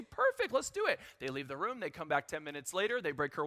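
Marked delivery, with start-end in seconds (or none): 0.0-1.0 much higher, chirpy mock agreement; mock eager. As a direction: sell it like a happy-go-lucky dad. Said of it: "And we say".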